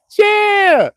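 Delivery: loud, very high, drawn-out "Chill!"; shouted "chill". Shown as a demonstration. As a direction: belt out one long high "Chill!". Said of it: "y'all can't hear them balls when I say that".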